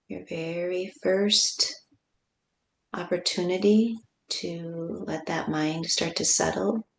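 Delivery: slow, unhurried guide with long pauses; guided meditation. Real, not acted. Teacher guiding listeners to feel the breath, calm meditation voice. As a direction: ease them into the breath, sinking to near-whisper at the end.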